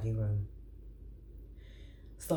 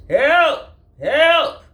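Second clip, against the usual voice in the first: high, loud cries for help; repeated cries; 17 dB louder than usual. Acted. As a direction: call out again and again. Armadillo still stuck.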